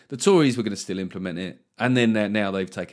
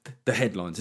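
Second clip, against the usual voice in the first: normal talking voice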